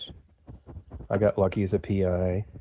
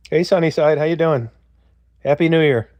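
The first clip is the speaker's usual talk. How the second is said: cheery greeting; 7 semitones higher, 5 dB louder than usual. Real, not acted. Greeting the caller on air.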